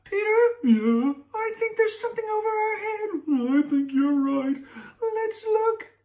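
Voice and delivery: put-on very high whiny little boy; child's voice. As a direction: squeak it like a scared kid. Boys spot the dragon.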